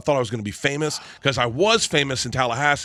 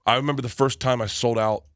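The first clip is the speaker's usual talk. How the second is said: ordinary talk, nothing marked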